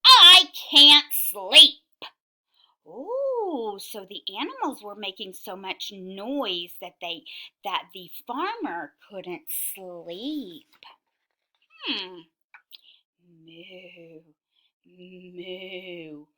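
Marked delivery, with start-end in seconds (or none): 0.1-1.8 loud, high, drawn-out complaint; farmer yelling. A narrator's reading. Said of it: "yelled the farmer". 13.5-16.3 soft, low moo; quieter cow. A narrator's reading. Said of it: "are they being quieter?".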